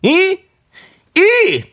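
very high, short cry of "이"; high-pitched toast call; Chungcheong toast demo. Shown as a demonstration. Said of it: "이렇게 하더라고".

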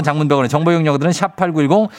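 quick ad disclaimer read; fast. Real, not acted. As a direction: rattle off the fine print. Fast legal fine print read.

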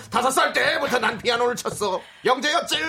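high, loud laughing grandpa voice; very old man. Pretend. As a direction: brag like an old grandfather, chuckling. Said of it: "왜 할아버지 연기한 거냐?". (웃으며 할아버지 흉내)

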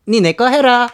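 귀엽게; cuter. Shown as a demonstration. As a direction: make the pickup line sound cute. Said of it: "좀 더 가-, 귀엽게".

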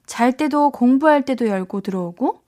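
ordinary talk

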